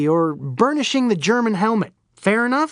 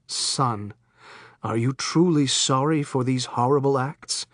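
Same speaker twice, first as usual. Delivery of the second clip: low, softer solemn priest voice; a priest pressing for repentance, low and soft; grave priest; 6 semitones lower, 4 dB quieter than usual. Acted. Priest asking the killer for contrition, low solemn voice.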